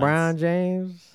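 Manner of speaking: very slow, stretched name; drawn out. Pretend. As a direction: stretch LeBron James out slowly. Slow comic echo.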